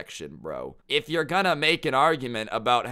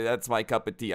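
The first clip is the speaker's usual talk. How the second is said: ordinary delivery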